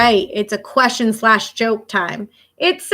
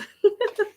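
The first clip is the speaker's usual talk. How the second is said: light laugh; 11 semitones higher, 3 dB quieter than usual. Real, not acted. Laughs at own joke.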